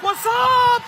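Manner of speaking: put-on high squeaky baby voice greeting; mock cutesy baby shout; babies' voice. Babies greeting the speaker, in a high squeaky voice. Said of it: "The babies are like".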